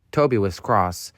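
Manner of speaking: ordinary delivery, nothing marked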